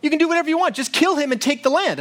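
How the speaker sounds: high, mocking queen scolding her husband; scornful Jezebel. Queen Jezebel scornfully goading her husband Ahab, pitched high. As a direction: scoff at a weak king. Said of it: "And she says".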